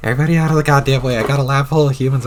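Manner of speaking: loud, fast bark to clear the room; hurried loud entrance yell; barging in. Acted. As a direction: shove through with your voice. Bursting into the room.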